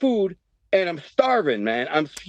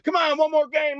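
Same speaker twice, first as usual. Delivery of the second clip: pleading; 11 semitones higher than usual. Retold or imitated. Begging friends for one more game.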